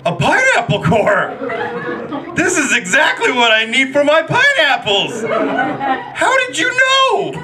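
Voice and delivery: high, hammed-up fake excitement over a gift; mock thrilled thank-you to the aunt; fake delighted gift thanks. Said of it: "going like".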